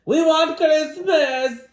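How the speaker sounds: slow, high, loud wail; drawn-out, demanding troll wail. A narrator's reading. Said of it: "they wailed".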